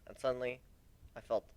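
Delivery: ordinary delivery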